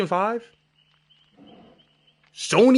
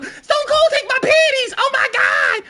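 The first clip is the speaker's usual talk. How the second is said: much higher, louder fangirl shriek; screaming fan; 4 dB louder, slower than usual. Retold or imitated. Woman in the crowd begging Stone Cold, shrieking. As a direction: squeal like a swooning fan.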